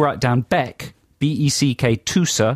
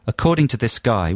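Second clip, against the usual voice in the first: normal talking voice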